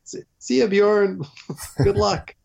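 high, mock-cheery goodbye; sarcastic farewell. Exhausted rider sarcastically bidding the local farewell, high and mock-cheery. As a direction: wave him off, never coming back. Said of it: "And I'm like".

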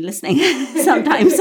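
laughing while speaking; amused, laughing it out. Real, not acted. Laughs about bored kids.

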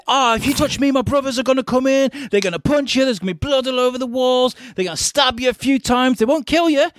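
put-on very high, childish taunting voice; squeaky kid brother threatening; bratty little brother. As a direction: play a cocky kid hiding behind his big brothers. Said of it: "like that kid that's got the older brother".

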